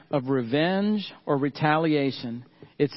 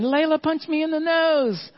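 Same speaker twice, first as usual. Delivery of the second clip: put-on high, whiny little boy voice; squeaky crying-kid voice; crying toddler; 12 semitones higher, 3 dB louder than usual. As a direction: voice a three-year-old wailing. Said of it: "he's saying".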